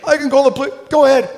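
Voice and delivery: loud, high retold standoff; adamant. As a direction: trade threats in mock outrage. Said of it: "She's, like, adamant".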